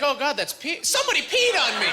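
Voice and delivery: high, rising alarmed realization; mock alarm. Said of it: "you're like".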